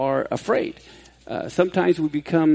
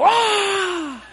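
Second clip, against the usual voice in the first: high startled yell, half laughing; retold yell; 14 semitones higher than usual. Father jumping at intruder, high retold yell. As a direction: let out a high scared yell. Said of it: "I jump up and I yell".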